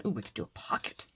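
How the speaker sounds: breathy whisper, half to herself; whispered idea. Real, not acted. Crafter whispering a new idea to herself. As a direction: whisper the idea as it comes to you.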